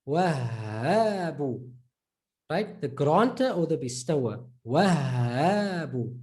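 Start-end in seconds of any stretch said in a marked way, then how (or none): 0.1-1.7 Wahhabu with a long held hh; emphasized double h. Shown as a demonstration. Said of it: "A little emphasis". 4.6-6.2 Wahhabu with a long held hh; emphasized double h. Shown as a demonstration. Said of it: "A little emphasis".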